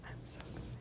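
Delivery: whispered, barely audible excitement; excited whisper. Real, not acted. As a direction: whisper it like a thrilled secret. Excited whisper while unboxing laptop.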